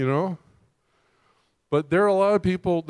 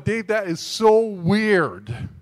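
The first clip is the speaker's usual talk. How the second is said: incredulous; 5 semitones higher, 5 dB louder, much slower than usual. Pretend. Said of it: "you're going".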